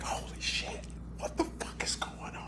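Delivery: tearful, stunned whisper; choked disbelief. Retold or imitated. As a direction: whisper it, overwhelmed and close to crying. Retells breaking down, near tears.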